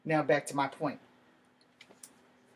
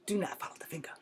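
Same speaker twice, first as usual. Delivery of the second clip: Bruce Lee quote; 5 dB quieter than usual. Retold or imitated. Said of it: "let me quote my favorite from Bruce Lee".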